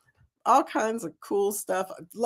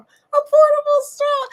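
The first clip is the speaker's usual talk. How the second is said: much higher, louder delighted squeal; excited squeal; 6 dB louder than usual. Acted. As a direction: squeal it with glee. Repeats with delight.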